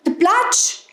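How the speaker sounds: high rising cry; alarmed hares. A narrator's reading. Hares warn about the lake, high alarmed cry.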